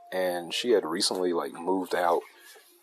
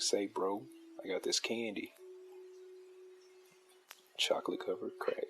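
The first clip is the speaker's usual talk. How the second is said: mock dealer pitch; 9 dB quieter than usual. Streamer jokingly plays a street dealer selling candy, sly and quiet. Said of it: "I messed that line up".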